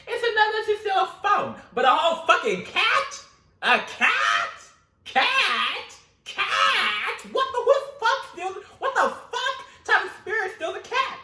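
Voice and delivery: high, loud, incredulous rant; outraged yelling. Retold or imitated. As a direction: yell in disbelief at the thief. Outraged rant at cat theft.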